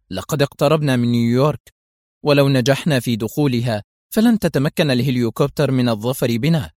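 usual speaking voice

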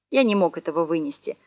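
plain reading voice

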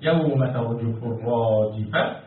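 low, slow measured chant; Quran recitation. Shown as a demonstration. Teacher cueing students to finish the verse, slow low recitation. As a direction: recite and leave the ending open.

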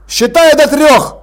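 commanding shout with a crossbow aimed; shouted count order. Acted. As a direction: bark the countdown at him. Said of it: "крикнул Ваймс".

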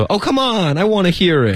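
cute, high-pitched pleading; sweetly whiny coaxing; Joey. Acted. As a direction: beg like an eager kid, pitch way up. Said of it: "就很可爱".

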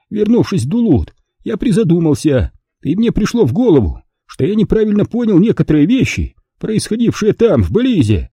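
high-pitched, excited explanation; agitated Lemuel. A narrator's reading. Voices Lemuel recounting his realisation.

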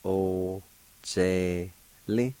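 "o, ze, li" in long, separated syllables. Shown as a demonstration. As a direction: sound out "o, ze, li" slowly for a learner. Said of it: "Second part".

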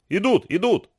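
crowd cries. A narrator's reading. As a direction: raise the pitch like a distant cry. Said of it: "послышались крики". (высокий, негромкий выкрик)

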